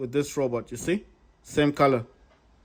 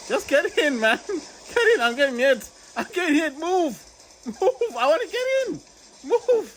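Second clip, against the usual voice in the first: high frantic yelling at teammates; urgent, high-pitched panic in battle; frantic excited; 13 semitones higher than usual. Real, not acted.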